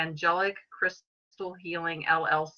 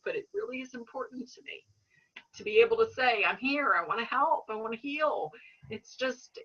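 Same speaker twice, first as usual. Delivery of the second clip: getting emotional; 4 semitones higher than usual. Real, not acted. Healer sharing her calling with the host, tearful and moved. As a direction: hold back real tears as you say it. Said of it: "I'm gonna get emotional and probably cry".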